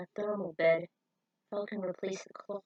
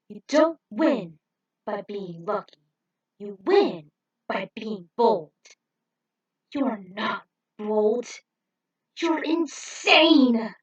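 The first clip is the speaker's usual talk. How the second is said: slow, firm and louder, ending in an outburst; stern mentor lecture; 7 dB louder, slower than usual. Acted. Falcon scolding Rick on boldness, slow and firm. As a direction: lay down the law to a reckless rookie.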